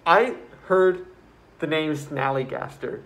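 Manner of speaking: usual speaking voice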